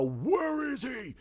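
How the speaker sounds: high, drawn-out mock yell; retold scream. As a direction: imitate a furious demand without full volume. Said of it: "he's screaming out".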